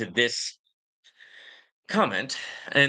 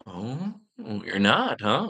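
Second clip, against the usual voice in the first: mock surprise; 4 semitones lower, much slower than usual.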